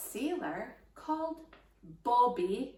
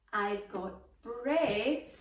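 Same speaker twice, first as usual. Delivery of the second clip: ordinary talk, nothing marked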